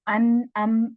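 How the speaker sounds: अन and अम with the nasal sound held; nasal sound demo. Shown as a demonstration. Teacher showing students the nasal ghunna on अन, अम. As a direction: let अन, अम ring through the nose. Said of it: "नून और मीम साकिन करके पढ़ लें".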